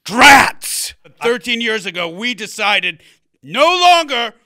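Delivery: high, loud mock-regal proclamation; theatrical royal, thwarted. Prince announcing a royal family decree, high theatrical declaiming. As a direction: burst out, then declaim like a stage prince.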